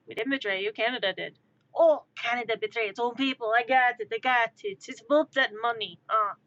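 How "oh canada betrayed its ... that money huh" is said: louder, aggrieved mock French accent; fake French accent. Betrayed smuggler protesting, fake French accent. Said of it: "my attempt at a French accent".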